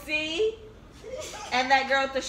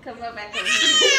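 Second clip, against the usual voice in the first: laughing call; 13 semitones higher than usual. Real, not acted. Calling child back, laughing.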